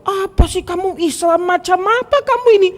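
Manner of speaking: very high-pitched indignant rebuke; scolding preacher. Retold or imitated. Ustadz scolds someone who asks about sins, mock indignation. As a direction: berate them in hammed-up outrage. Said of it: "Ada ustadz yang jawabannya begini".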